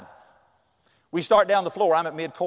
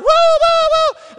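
imitating fans, much higher and louder whoops; crowd whooping; 8 dB louder, much slower than usual. Fans parading him around the gym, loud whoops. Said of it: "Everybody's hollering".

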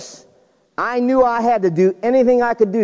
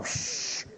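ski hiss; 18 dB quieter than usual. Retold or imitated. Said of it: "those skis in the snow".

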